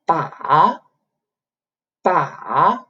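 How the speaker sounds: ba dips low then rises; dipping tone. Shown as a demonstration. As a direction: sink ba down and back up. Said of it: "you go down a little bit and then go up".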